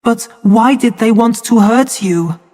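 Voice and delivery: put-on a small boy asking his mother, gently; child's question. A narrator's reading. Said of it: "he asked that night".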